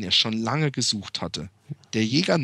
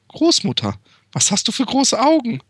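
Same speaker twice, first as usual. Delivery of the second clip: put-on higher, louder girl asking; Little Red voice; 9 semitones higher, 4 dB louder than usual. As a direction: ask like a wide-eyed little girl. Little Red asks again.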